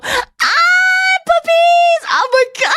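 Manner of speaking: piercingly high mock squeal; hammed-up excitement. What she wouldn't do entering the elevator, mock-excited squeal. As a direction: shriek in exaggerated puppy delight. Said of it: "I was very excited to see those dogs".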